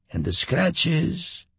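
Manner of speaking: lightly voiced old Homer; old man voice. A narrator's reading.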